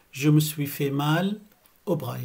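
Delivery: slow, carefully spaced sentence; slow clear model. Shown as a demonstration. Teacher modelling a doctor phrase for learners, slow. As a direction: lay each word out for the class. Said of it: "هيك بتنقال بهالطريقة".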